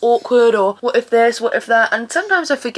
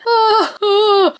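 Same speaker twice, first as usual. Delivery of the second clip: high, louder overwhelmed ah; emotional cry; 9 semitones higher, 4 dB louder than usual. Real, not acted. Thinking of the film, overwhelmed near-tearful ah. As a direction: gush near tears. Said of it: "I'm gonna start crying".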